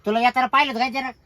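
high, loud agitated questioning; too loud. Acted. Asking the mistress how the boy saw them, agitated and loud. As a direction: forget to keep the voice down. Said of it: "हळू बोलावं तुम्ही".

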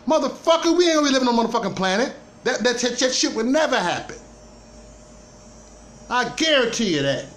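heated outburst. Real, not acted. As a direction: blow up at the idea in disbelief. Heated rant about living on Mars.

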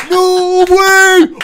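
huge high-pitched disbelieving yell; loud imitated astonishment; mind-blown. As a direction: scream it like a drunk whose mind just exploded. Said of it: "His head fucking explodes. He loses his mind".

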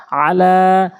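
আলা with লা held long; long second vowel. Shown as a demonstration. Teacher applying madd in a word, long final vowel. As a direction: stretch the end of আলা. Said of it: "প্রয়োগ করে পড়ব".

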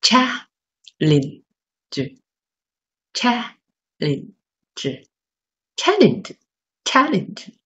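"challenge" very slowly, stress on "chal", "lenge" light; drawn-out drill of "challenge", first syllable leaned on. Shown as a demonstration. Teacher drilling the word for students, slow with front stress. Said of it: "所以先看重音在前面".

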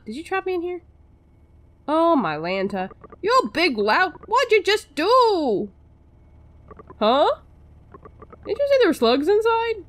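the trapped doctor berating the lout; indignant character. Acted. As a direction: scold the big lout. Their voices are all changing.

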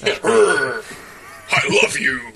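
hammed-up, forceful love declaration; mock aggressive declaration. Friend teasing the rose-shoving boyfriend, mock aggressive declaration.

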